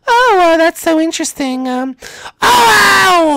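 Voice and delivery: high falsetto princess voice; girl voice. Acted. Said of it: "I'll do a girl voice".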